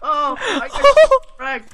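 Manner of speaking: slow, loud, laughing out the words; groan-laugh through a defeat; laughing yell. Real, not acted. Just lost the fight.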